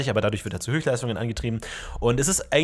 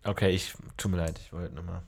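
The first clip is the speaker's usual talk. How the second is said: small, soft, meek sorry; meek apology; 7 semitones lower, 9 dB quieter than usual. Acted. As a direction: apologise timidly, almost muttered.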